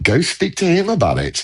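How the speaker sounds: telling them off. Retold or imitated. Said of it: "I'm just like".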